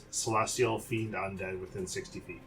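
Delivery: normal talking voice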